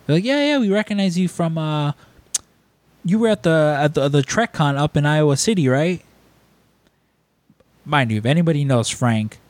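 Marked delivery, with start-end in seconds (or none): 0.2-6.0 higher-pitched imitation of insistent fans; eager recognition. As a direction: press him as a mistaken fan would. Strangers insist they recognize Frank.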